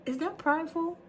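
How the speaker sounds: high-pitched self-check; suddenly high, questioning yourself; mock self-doubt. Real, not acted. Catching herself in pride, high questioning aside.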